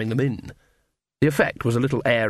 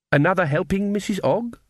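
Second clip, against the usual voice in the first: higher, lilting offer; hostess's voice; 7 semitones higher than usual. A narrator's reading. Mrs Gogol offers Nanny another helping, lilting hostess voice. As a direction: offer more in a raised, questioning lilt.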